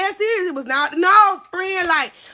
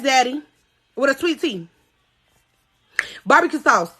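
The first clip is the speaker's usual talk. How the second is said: ordinary talk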